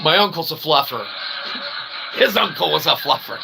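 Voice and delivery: ordinary delivery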